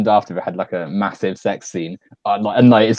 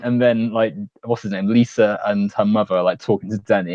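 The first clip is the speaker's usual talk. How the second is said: ordinary talk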